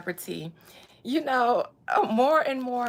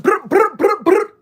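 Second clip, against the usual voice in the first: high, loud rolled brr ad-lib; hype-man trill, loud and high; rapper ad-lib brr; 11 semitones higher, 14 dB louder than usual. Pretend.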